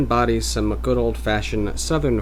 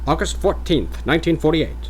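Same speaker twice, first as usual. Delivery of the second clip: higher, formal Mid-Atlantic announcer; Mid-Atlantic accent; 6 semitones higher, 5 dB louder than usual. Acted. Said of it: "mock Mid-Atlantic voice".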